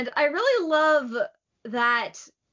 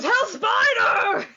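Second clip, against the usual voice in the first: high, loud, drawn-out battle cry; attack name shout; 11 semitones higher, 5 dB louder, much slower than usual. Retold or imitated. Endeavor yelling his attack at Shigaraki, a big battle cry.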